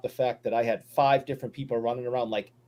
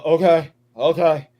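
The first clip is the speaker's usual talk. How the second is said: dazed okay; 9 dB louder than usual. Retold or imitated. Retold dazed agreement on phone.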